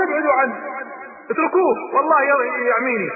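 high, panicked plea to call them off; panicked scream. Retold or imitated. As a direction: cry out in pain and fear. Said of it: "فصرخ عتبة".

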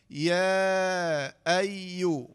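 long "يا", then doubled y in "أيو"; elongated madd and shadda. Shown as a demonstration. Teacher building the word by syllables, drawn-out "يا أيو". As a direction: draw out "يا", lean on the doubled "أيو". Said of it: "شدة ضمة".

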